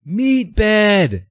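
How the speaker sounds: high, loud shout of a screen name; retold yell. Fans yell his gamer screen name at him. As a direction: yell it out across the field. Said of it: "they go".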